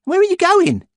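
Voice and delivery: high, loud schoolboy voice; boy character voice. A narrator's reading. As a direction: call out as a young boy. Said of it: "Ian Grant ... said".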